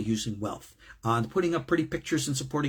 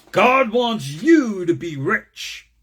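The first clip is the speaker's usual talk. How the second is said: slow, loud, higher mock proclamation of "God wants you to be rich"; mocking false-preacher quote; 6 semitones higher, 8 dB louder, much slower than usual. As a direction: drag it out like a televangelist you're ridiculing. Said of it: "To say ... is a lie".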